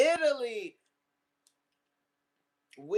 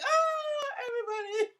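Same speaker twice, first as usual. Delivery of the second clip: excited greeting. Retold or imitated. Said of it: "I was just like".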